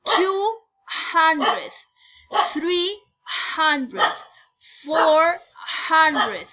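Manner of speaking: slow, loud English count for the class; teacher drilling one hundred to four hundred. Shown as a demonstration. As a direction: call out each number, then hundred. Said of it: "diríamos one hundred".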